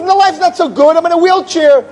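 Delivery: high-pitched, cranky complaint; angry old man. Acted. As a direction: argue back in a high, miserable voice. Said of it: "angry old man you ever met".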